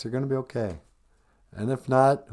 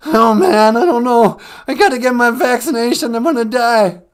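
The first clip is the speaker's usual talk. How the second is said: much louder, higher panicked caller; a scared caller losing his nerve; Cowardly Lion freaking out; 9 semitones higher, 11 dB louder than usual. Acted. Radio caller panicking about the vaccine, loud and high. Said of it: "it's a caller, Cowardly Lion".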